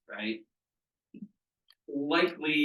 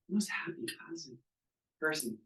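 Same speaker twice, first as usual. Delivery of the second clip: hushed emphasis; 3 semitones higher, 8 dB quieter than usual. Real, not acted. Describing a cheerful client.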